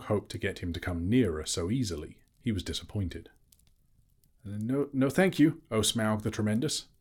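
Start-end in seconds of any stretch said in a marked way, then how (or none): 4.4-6.9 higher, louder hobbit voice; nervous Bilbo. A narrator's reading. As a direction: flatter the dragon nervously. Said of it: "he replied".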